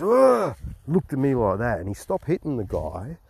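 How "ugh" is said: a sharp high grunt; high mock grunt. Army guy caught beating an old man, high startled grunt. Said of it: "And he goes".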